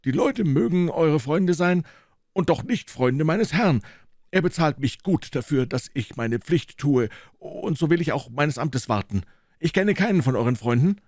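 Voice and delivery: a guard who won't budge; gruff gatekeeper. Acted. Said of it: "der unerschütterliche Torwart".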